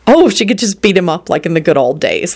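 ordinary delivery

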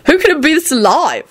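high, piping indignant question; upset Timothy. A narrator's reading. Timothy challenging the adults, upset. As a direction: ask it high and agitated.

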